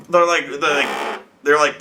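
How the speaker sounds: usual speaking voice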